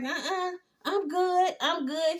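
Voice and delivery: mock breathless gasping; out of breath. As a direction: fake winded after jogging. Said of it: "He all out of breath".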